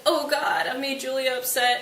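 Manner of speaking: high hammed-up anguish; mock wailing Romeo. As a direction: lament like a melodramatic lover. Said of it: "he's crying and wailing on the floor".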